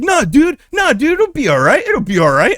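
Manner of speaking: very high, loud reassuring friend voice; mock consoling friend. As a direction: squeak out comfort like a panicky buddy.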